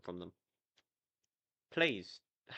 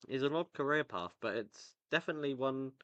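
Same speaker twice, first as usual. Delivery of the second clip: ordinary delivery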